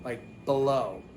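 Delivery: below with a weak buh start; relaxed below, first vowel reduced; schwa be. Shown as a demonstration. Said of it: "usually people say b".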